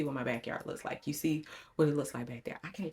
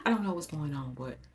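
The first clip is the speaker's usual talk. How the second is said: usual speaking voice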